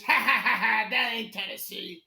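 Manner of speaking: imitated laugh in someone's face; mock cackling bar owner. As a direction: act out a scornful "ha ha ha", then the put-down. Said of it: "she laughed in my face".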